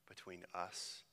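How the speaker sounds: whispered prayer close. Real, not acted. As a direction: breathe the words softly.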